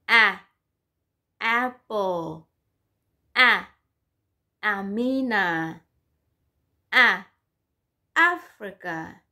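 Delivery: short open "a" in "apple", "Africa", "Amina", "Alligator"; short A drill. Shown as a demonstration. Teacher drilling pupils on the short "a" sound. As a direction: drill the short "a" sound, each word clear. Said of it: "The short sound of the letter A".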